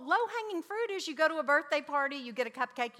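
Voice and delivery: ordinary delivery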